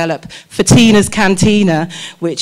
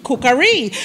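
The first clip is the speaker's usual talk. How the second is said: higher, hammed-up greeting as Mama Cookery; Missus Mama character; 6 semitones higher than usual. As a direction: perform the character hello.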